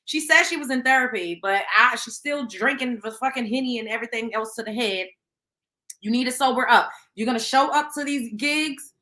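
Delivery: normal talking voice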